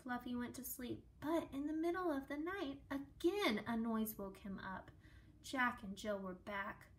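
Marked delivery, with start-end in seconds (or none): none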